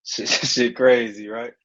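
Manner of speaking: laughing. Real, not acted. Laughing after the trance.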